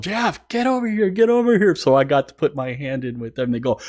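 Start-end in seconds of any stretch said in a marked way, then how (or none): 0.0-1.7 high, urgent mock yell; retold yell. Band yelling him into the huddle, mock yell. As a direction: imitate bandmates calling someone over, pitched way up. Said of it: "some of them yelled".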